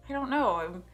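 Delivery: ordinary talk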